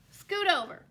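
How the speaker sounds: squeezed duck complaining to the goose. A narrator's reading. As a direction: whine about the lack of room. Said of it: "complained Duck".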